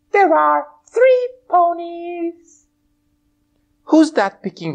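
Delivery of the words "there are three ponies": high bright pony voice; a cheerful high puppet pony. Acted. Twilight puppet answering host, high pony voice.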